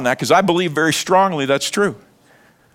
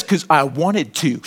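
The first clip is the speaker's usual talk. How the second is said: cheeky defiance; 3 semitones lower, slower than usual. Retold or imitated. Defiant driver answering cop.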